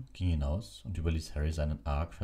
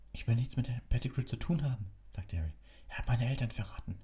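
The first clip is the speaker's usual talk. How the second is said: put-on upset boy; 8 semitones higher than usual. A narrator's reading. Harry voiced in story.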